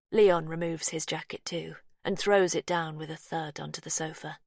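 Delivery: plain reading voice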